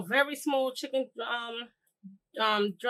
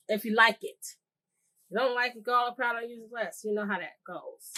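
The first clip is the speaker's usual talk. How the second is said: ordinary delivery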